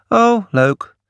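high, offhand, pretending not to care; feigned indifference. A narrator's reading. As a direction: act like it's nothing. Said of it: "Hij deed net of het hem niets kon schelen".

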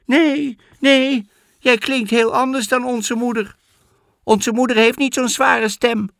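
high, loud, scared little goats; frightened kids. Acted. Goat kids refusing the wolf at the door, scared high cries. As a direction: cry out in fear like small kids. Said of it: "riepen de geitjes bang".

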